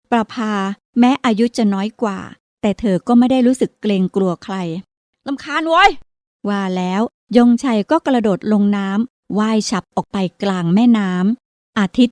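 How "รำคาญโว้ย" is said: annoyed yell. Acted. Boy yells in annoyance.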